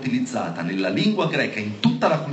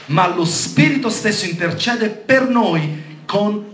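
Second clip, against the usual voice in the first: higher-pitched, insistent preacher reading; emphatic scripture proclamation; 7 semitones higher, 4 dB louder than usual. Real, not acted. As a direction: proclaim the verse with rising fervour. (proclamazione biblica accorata)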